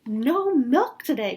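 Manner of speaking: loud, slow, dismayed cry. Acted. Farmer Brown reads the cows' note, crying out. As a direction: cry it out, aghast. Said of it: "cried Farmer Brown".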